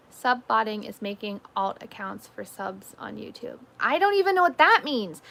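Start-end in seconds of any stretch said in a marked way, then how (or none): 3.8-5.2 higher and louder, hammed-up confusion; mock exasperation. Real, not acted. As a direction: throw up your hands about it. Mock-exasperated repeat of earlier line.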